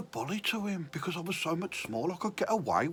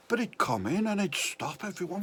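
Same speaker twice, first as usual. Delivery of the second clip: ordinary talk, nothing marked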